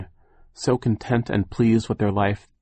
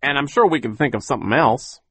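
high, loud, triumphant; Phil; 5 semitones higher, 5 dB louder than usual. A narrator's reading. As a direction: announce it like good news. Optimistic Phil continues.

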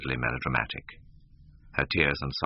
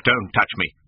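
sharp loud cry, pitched up; 4 semitones higher, 7 dB louder than usual. A narrator's reading. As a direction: fling the words at her to push her away. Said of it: "he cried".

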